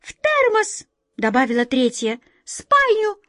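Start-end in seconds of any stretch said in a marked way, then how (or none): 0.0-0.9 very high tiny mouse voice; high-pitched squeaky little creature; mouse squeak. A narrator's reading. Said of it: "пискнула вторая". 2.4-3.2 very high tiny mouse voice; high-pitched squeaky little creature; mouse squeak. A narrator's reading. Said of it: "пискнула вторая".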